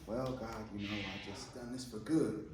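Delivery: soft, low, whining excuse; whiny Moses. Retold or imitated. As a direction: mumble it like a tired reluctant Moses. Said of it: "and he's just saying".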